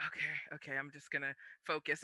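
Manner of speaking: hushed, steadying self-talk; calm yourself down under your breath; quietly psyching himself up before the bout; nervous self-pep talk. Retold or imitated.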